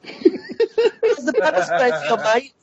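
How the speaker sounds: slow, high, hammed-up Aussie accent; a put-on Aussie bloke teasing someone; mock Aussie accent. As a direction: drag out a jokey Aussie taunt. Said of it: "Please stop doing accents".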